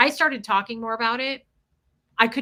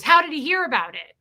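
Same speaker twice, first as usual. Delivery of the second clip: high, loud suspicious question; imitated paranoia; 5 semitones higher, 5 dB louder than usual. Teller imitates Jeff asking how he heard, paranoid and loud. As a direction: mimic his alarmed disbelief. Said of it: "He was so paranoid".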